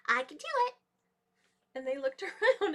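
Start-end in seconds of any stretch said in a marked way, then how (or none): 0.1-0.7 high little creature voice; tiny voice. A narrator's reading. The animals hear the voice again, tiny and high. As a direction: speak up small and squeaky. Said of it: "again they heard the voice".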